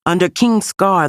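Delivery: usual speaking voice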